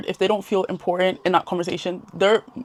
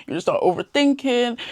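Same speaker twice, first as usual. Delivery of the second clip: usual speaking voice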